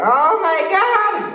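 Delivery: loud high slow gasp; drawn-out shocked cry; shocked shout. Acted. Sees a giant.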